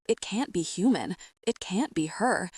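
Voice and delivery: ordinary delivery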